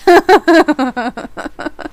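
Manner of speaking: long, high burst of giggling; amused laugh. Real, not acted. Streamer admitting liking a friend's accent, amused laugh. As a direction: laugh loudly at your own confession.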